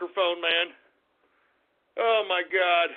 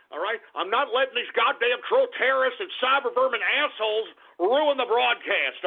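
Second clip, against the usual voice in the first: normal talking voice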